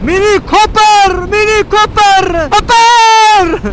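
very high, loud, slow yell; shouting. Real, not acted. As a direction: belt the name out slowly. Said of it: "bu deli niye bağırıyor".

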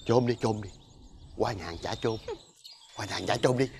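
low, hushed conspiratorial order; furtive urging. Acted. Father telling his child to steal from next door, hushed and furtive. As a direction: urge it softly, as a secret.

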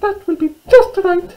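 very high, squeaky mouse voice; little mouse. Acted. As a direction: squeak it out like a tiny storybook mouse. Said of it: "she said".

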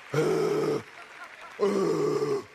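ordinary talk

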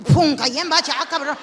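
sudden loud burst as she drops into the hole; jolted yelp of surprise; startled yelp. Pretend. Woman falling into her husband's mattress dip, startled yelp.

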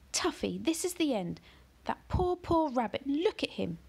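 put-on high, upset girl's scolding; Ellie reproaching. A narrator's reading.

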